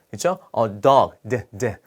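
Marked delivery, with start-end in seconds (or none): 1.3-1.8 "d" as a soft, short bare consonant, twice; isolated consonant sound. Shown as a demonstration. Teacher showing the first sound of dog, isolated consonant. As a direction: sound out just the "d", lower and softer. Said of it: "첫 소리가 자음으로 발음되는".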